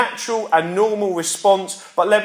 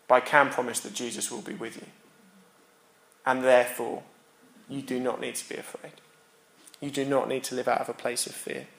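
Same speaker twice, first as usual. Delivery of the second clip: soft reassurance; 6 semitones lower, 9 dB quieter, faster than usual. Real, not acted. Closing pastoral reassurance.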